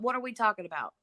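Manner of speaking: ordinary delivery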